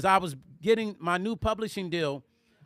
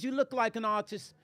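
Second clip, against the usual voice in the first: softly imitated British woman; British accent; 6 dB quieter than usual. Woman explaining her guess to a stranger, mock British accent. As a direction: answer in a put-on British accent. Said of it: "she had a British accent".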